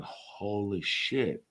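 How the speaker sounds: drawn out and dropped as if staring at it; soft slow stunned curse; stunned disbelief. Retold or imitated. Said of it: "their friend with the camera's going".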